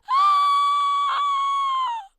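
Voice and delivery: shrieking high gasp of horror; loud, high horrified gasp. Acted. Horrified at pimples.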